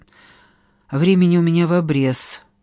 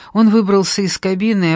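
ordinary delivery

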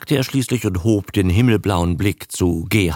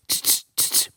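clicking tongue; 7 dB quieter, faster than usual. Retold or imitated. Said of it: "und mache tz".

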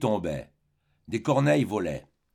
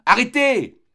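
a piercing, much higher, loud scream of alarm; alarmed cry; 12 semitones higher, 10 dB louder, slower than usual. Acted. The servant boy crying out to stop Emma, panicked scream. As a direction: cry out as you lunge to stop her. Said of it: "s'écria-t-il en se jetant sur elle".

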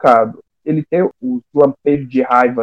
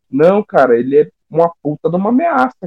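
normal talking voice

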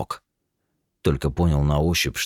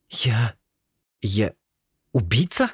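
halting disbelief; 8 semitones higher, slower than usual. Acted. Shocked halting question.

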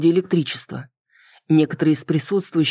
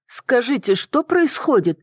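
заметно выше, вопрос растянут к концу; спроси высоким голосом встревоженной посетительницы; пожилая женщина; 7 semitones higher, slower than usual. A narrator's reading. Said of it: "осведомилась пожилая женщина". (высокий голос пожилой дамы)

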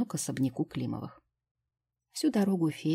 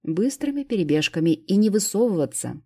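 louder, higher order; loud command; 4 semitones higher, 7 dB louder than usual. A narrator's reading. Vitalik hurrying the fairy to the car, loud order. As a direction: drive her on urgently. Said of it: "скомандовал я".

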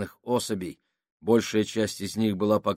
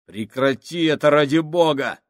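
pleading shout; 6 semitones higher, 7 dB louder than usual. Acted. Fabrini panics at the boat.

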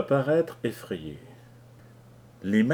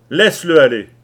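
loud, commanding order; 5 semitones higher, 14 dB louder than usual. Acted. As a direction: bark an order at Kelly. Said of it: "ordonna Leach". (ordre sec et fort)

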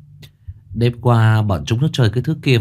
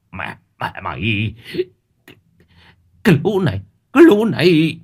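horrified rage; 6 semitones higher than usual. Acted. Old man horrified at the eye.

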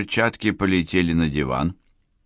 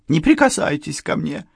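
higher, sharp outcry; cry out; 10 semitones higher than usual. Acted. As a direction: push him away with a cry. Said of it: "вскрикнула она".

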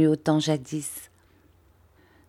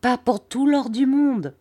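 fervent refusal; 7 semitones higher than usual. A narrator's reading. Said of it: "s'écria Peggotty".

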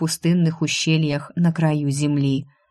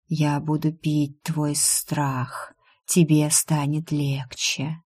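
slow, softened hypnotic voice; drawn-out, hushed otherworldly murmur; eerie whisperer; 4 dB quieter, slower than usual. A narrator's reading. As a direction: lull them slowly and quietly. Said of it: "шепчет мне кто-то на ухо".

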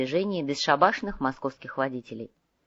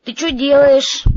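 much louder, high and drawn out; loud demanding question; 9 semitones higher, 12 dB louder, slower than usual. Acted. Bored Domingo calls to his busy partner, loud and demanding. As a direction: call it out across the room.

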